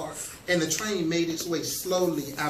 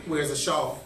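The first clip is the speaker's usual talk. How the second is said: ordinary delivery, nothing marked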